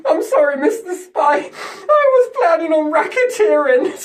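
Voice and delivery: high, loud, hammed-up pleading; mock tearful confession. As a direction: blubber it out in an exaggerated squeal. Acted confession to the spy.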